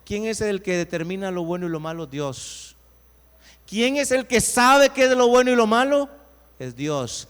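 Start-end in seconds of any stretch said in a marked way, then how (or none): 3.7-6.1 loud, higher rousing question; fiery preacher's question; preaching at full force. Acted. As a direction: challenge the congregation loudly.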